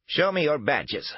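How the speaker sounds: put-on high, slow old woman's voice; elderly woman voice. A narrator's reading. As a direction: proclaim it like a wary old lady. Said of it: "the elderly voice proclaimed".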